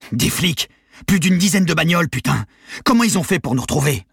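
high, loud panicked cursing; alarmed outburst. A narrator's reading. Gangster spotting police outside farm, alarmed outburst. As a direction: blurt it out in rising alarm.

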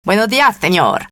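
silly quacking duck voice. Acted. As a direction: greet him in a silly duck voice. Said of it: "avec une voix de canard".

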